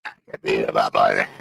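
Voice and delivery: mocking the witness's rasp; mobster rasp. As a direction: croak it quickly like a cement mixer. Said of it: "Sounds like a fucking cement mixer".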